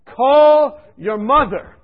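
slow, loud, high booming command; voice from above. Retold or imitated. A mystic voice ordering him to call his mother, slow and booming. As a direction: call out like a voice from heaven. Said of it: "this voice came to me".

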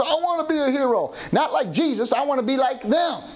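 lightly voiced eager child, high pitch; starstruck kid. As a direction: imitate a youngster longing to copy a hero. Voices kids dazzled by soldiers.

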